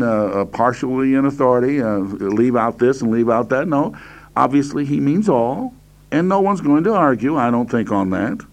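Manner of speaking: usual speaking voice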